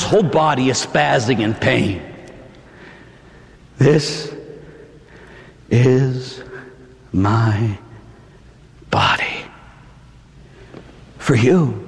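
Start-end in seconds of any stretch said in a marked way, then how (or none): 3.8-11.7 hushed words of Jesus. Acted. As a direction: lay each word down slowly, like a priest at the altar. Voicing Jesus at last supper.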